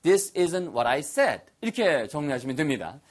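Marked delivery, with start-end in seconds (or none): 0.0-1.4 very slow, clear model sentence; slow teacher demo. Shown as a demonstration. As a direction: stretch each word for learners. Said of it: "아시겠죠?".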